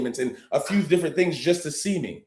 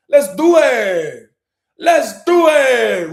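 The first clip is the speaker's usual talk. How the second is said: slow, loud, drawn-out rallying cry; hype call; 6 semitones higher, 9 dB louder, much slower than usual. Real, not acted. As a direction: stretch it out to pump up the crowd. Hyping up the live audience.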